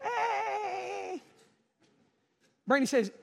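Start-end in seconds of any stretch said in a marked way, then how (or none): 0.0-1.2 high, soft whining mock wail; fake cry. Preacher mimicking little girl's fake cries, high mock wail. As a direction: let out a thin, put-on child's whimper. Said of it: "you know the difference when Emmy fake cries".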